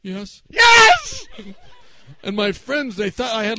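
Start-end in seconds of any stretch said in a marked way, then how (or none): 0.0-0.4 soft, held-back "yes"; discreet answer. Retold or imitated. Quiet answer before the yell. 0.5-1.3 very high, loud "yes!"; exasperated yell. Retold or imitated. Yelling at deaf grandfather.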